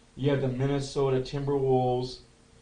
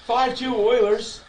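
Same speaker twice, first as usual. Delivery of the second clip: play-by-play call; 5 semitones higher than usual. Acted. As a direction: call the game like a broadcaster. Calls the game play-by-play.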